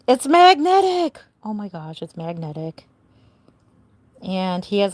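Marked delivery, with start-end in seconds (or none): 0.1-1.2 higher and louder, thrilled at the discovery; showing off a fun surprise to viewers, excited; excited exclamation. Real, not acted. Delighted discovery of magnet. 1.4-2.9 much softer, amazed under the breath; musing to herself after the outburst, hushed; quiet aside. Real, not acted. Repeats discovery under breath.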